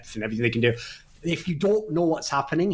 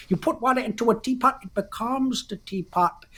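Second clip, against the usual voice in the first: pitched-up mock Chinese-English accent, slowing on the punchline; mock Bruce Lee accent; 7 semitones higher than usual. Host mimics the interview for his co-host in a mock Chinese-English accent. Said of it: "I'm doing a sick accent here as well".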